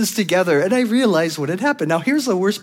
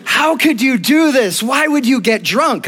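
mock outraged mother; 8 semitones higher, 4 dB louder than usual. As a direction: wail at your son in hammed-up dismay. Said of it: "Voicemail number two".

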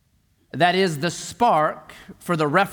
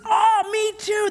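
much higher, slow, excited shout; hammed-up eager cheer; slower than usual. Rescuer delighted the man shares his faith, hammed-up shout. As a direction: gush with exaggerated delight.

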